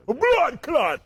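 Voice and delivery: ordinary delivery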